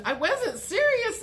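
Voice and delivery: high pleading take-back; mock backpedal. Gemini backpedalling after the threat, high pleading. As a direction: plead it was a joke.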